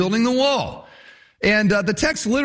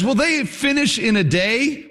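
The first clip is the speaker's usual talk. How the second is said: mocking; 6 semitones higher than usual. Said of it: "He mocks their enthusiasm".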